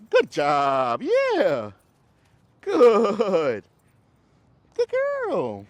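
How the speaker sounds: real high-pitched dog praise. Real, not acted. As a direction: cheer the dog on in a sky-high voice.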